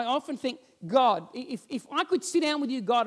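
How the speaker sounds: ordinary delivery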